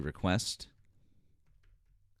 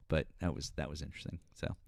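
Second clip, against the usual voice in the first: usual speaking voice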